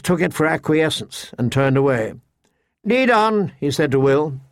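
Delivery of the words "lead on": put-on haughty young lord. A narrator's reading. Lordling's command, higher voice.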